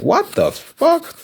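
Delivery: high, slow, disbelieving yelp; shocked. Retold or imitated. Learning friends met without her, a shocked high slow yelp. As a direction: squeal it out in total disbelief.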